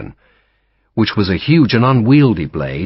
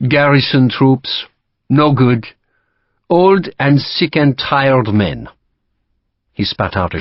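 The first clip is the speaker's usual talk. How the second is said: French officer; 5 semitones higher, 3 dB louder than usual. Acted. Lacroix to Sharp, dismissive French accent.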